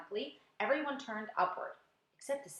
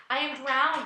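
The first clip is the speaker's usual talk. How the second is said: a touch of gasping, louder; 4 semitones higher, 7 dB louder than usual. Acted. Old grasshopper gasping after the flood, mildly gasped. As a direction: gasp it out, half drowned. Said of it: "gasped the old green grasshopper".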